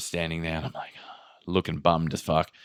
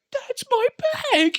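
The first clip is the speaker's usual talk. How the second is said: excited discovery. Retold or imitated. Traveler spots the taped bag across the room, excited discovery. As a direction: re-enact spotting long-lost luggage with a high cry. Spotting the missing bag at last.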